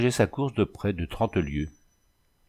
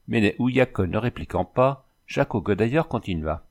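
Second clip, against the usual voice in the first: ordinary delivery, nothing marked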